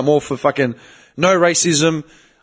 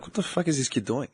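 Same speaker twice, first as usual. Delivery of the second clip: much softer, under the breath; muttered disbelief; 2 semitones lower, 13 dB quieter than usual. Retold or imitated. Party guests eyeing a wild kid, muttered disbelief. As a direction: mutter it as a quiet aside.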